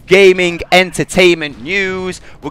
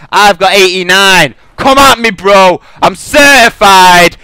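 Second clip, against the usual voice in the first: louder, higher taunting brag, slow at the end; loud trash talk; 6 semitones higher, 9 dB louder, slower than usual. Real, not acted. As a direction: taunt the fanboys at full voice. Trash-talking rival players.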